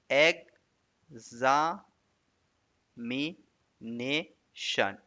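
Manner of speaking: "एग्जामिनेशन" very slowly, a long pause after each syllable. Shown as a demonstration. Teacher counting syllables, "एग्जामिनेशन" slowly split. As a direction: break "एग्जामिनेशन" into five separate, drawn-out syllables. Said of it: "बस कितना syllable हुआ".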